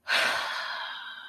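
long, breathy exhausted sigh; exasperated sigh. Real, not acted. Vlogger fed up with Ella's lies, sighing. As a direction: sigh like you're done with her. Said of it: "exasperated sigh".

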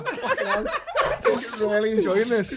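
very high, slow, laughing through the words. Real, not acted. Co-host cracking up at a rambling mate, laughing. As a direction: giggle it out, delighted.

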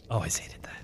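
breathy whispered aside. Acted. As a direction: hiss it under your breath. Player mutters in character.

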